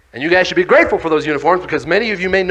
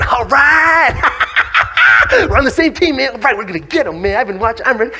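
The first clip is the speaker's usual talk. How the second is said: high, loud whooping with laughter; excited cheering; 7 semitones higher, 3 dB louder than usual. Retold or imitated. Children cheering after choosing their team, high and laughing. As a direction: cheer like you've picked the winning team. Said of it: "We're all over here going".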